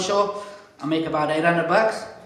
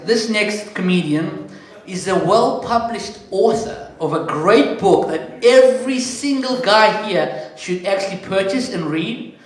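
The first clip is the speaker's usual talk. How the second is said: drawn-out, louder host intro; slow MC build-up; 3 semitones higher, 5 dB louder than usual. Acted. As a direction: sell the author to the room. Introducing the next comedian.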